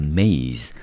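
maze said as one clear syllable, mayz; syllable maze spoken as a demo; pronouncing maze. Shown as a demonstration. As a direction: spell out then say maze slowly. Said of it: "M A Z E，我们念成".

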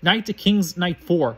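usual speaking voice

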